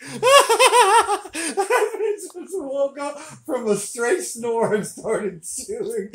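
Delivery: slow, high, wheezing with laughter; words squeezed out between giggles; helpless laughing. Real, not acted. Cracking up at the snoring eater.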